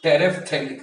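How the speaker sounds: "terephthalic" with an audible f before th; PH sounded. Shown as a demonstration. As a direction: pronounce "terephthalic" as te-ref-THAL-ik. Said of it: "इनमें पीएच का sound आएगा". ("terephthalic" में पीएच को फ़ बोलें)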